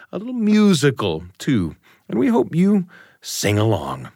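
usual speaking voice

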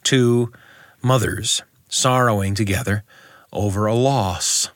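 ordinary delivery, nothing marked

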